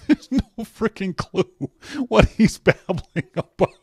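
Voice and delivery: high, slow, laughing words; amused bafflement. Real, not acted. Host has no clue about Les's babbling, laughing bafflement. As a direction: talk through helpless giggles.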